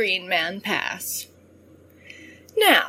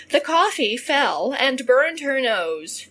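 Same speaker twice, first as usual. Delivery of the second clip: ordinary delivery, nothing marked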